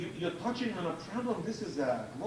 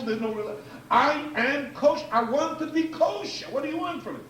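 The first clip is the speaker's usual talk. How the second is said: imagined pleading parent; 6 semitones higher, 4 dB louder than usual. Retold or imitated. Parent defending his simcha to the community, pleading and insistent. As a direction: argue your case as a parent under fire. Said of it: "This person cannot get up and say".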